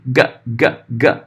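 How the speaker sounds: g, g, g as short soft clicks in the throat; teacher demoing a plosive g. Shown as a demonstration. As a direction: snap the g like a little click. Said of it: "o G também".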